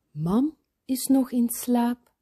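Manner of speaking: ordinary delivery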